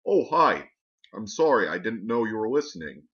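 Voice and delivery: awkwardly sustained fake robot voice. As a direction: keep up the fake voice, caught out. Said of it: "a fake voice".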